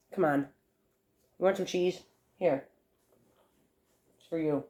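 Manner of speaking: ordinary talk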